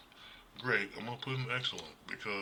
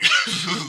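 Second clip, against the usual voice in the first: breathy laugh; 10 semitones higher, 16 dB louder than usual. Real, not acted. Laughing at horrible movie.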